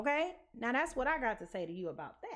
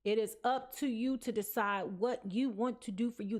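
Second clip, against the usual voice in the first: ordinary talk, nothing marked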